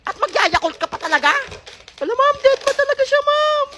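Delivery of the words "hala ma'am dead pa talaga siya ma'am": put-on high kid voice complaining to ma'am; high whiny student; whiny child. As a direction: complain to the teacher like a little kid. Child tattling to teacher.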